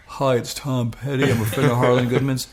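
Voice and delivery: acted musician's Gainesville accent; [name]. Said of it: "best I can do is my Gainesville accent".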